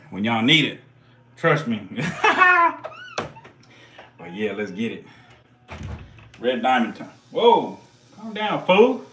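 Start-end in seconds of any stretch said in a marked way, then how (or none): 1.9-3.5 high laugh. Real, not acted. Laughs after joke. 7.3-9.0 startled. Real, not acted. Snake strikes at him.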